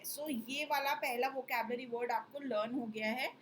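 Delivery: usual speaking voice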